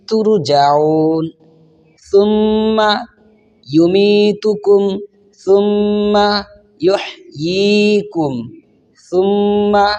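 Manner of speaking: Quran recitation. Shown as a demonstration. Teacher reciting the verse for students, slow melodic chant. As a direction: recite it in full tajweed, drawing out the vowels. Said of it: "আবার repeat করে আসি।".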